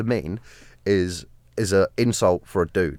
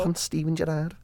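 higher, softer mock Scouse; Scouse impression; 8 semitones higher, 7 dB quieter than usual. Repeating the footballer bit for a mate, Scouse accent. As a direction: put on a high, throaty Scouse voice. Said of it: "you're good at accents".

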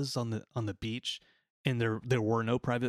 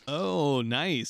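ordinary talk, nothing marked

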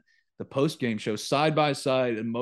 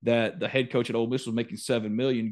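usual speaking voice

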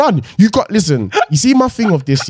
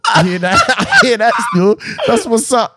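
laughing agreement; 10 semitones higher than usual. Pretend. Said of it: "Oh my God".

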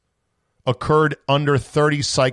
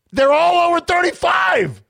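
real outraged yell, much higher and louder; 4 dB louder than usual. Real, not acted. Host losing it over test cycle counts, yelling high and loud. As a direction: blow up at the lab numbers. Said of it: "Am I yelling? I'm yelling".

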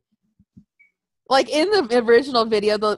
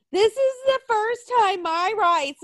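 high, drawn-out whine of protest; mock outrage; 8 semitones higher than usual. Mimicking people who complain about their rights, mock outrage. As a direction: imitate an indignant complainer, pitched up and slow.

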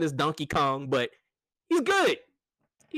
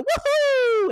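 high, louder cheerful Mario "woohoo"; 6 dB louder than usual. Retold or imitated. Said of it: "the little intangibles that Mario does".

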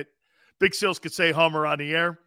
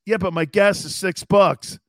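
usual speaking voice

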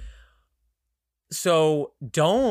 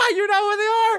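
laughing; 4 dB louder than usual. Real, not acted. Laughing sly tease to co-host.

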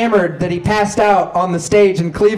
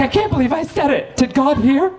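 guilty dad; 8 semitones higher than usual. Retold or imitated. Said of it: "He was like".

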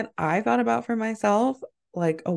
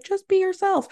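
parent's advice; 10 semitones higher than usual. Retold or imitated. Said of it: "your parents were always like".

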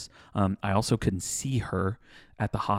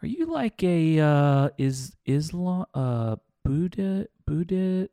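high, slow, puzzled asking voice; mock a baffled stranger fumbling for words; confused customer; 9 semitones higher, much slower than usual. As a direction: customer fumbling an awkward question, hesitant and puzzled. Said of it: "someone was like".

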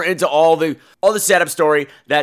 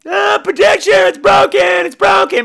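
mock shout; 7 dB louder than usual. Mocking the broken projection staff.

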